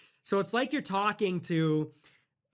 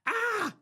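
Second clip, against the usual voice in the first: mock crying ah. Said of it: "She goes".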